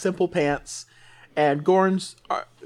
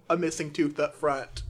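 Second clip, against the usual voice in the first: usual speaking voice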